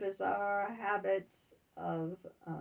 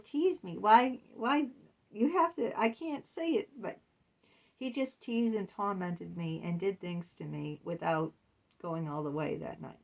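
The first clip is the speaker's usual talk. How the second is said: ordinary talk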